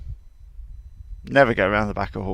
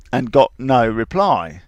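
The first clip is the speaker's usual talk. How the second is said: ordinary delivery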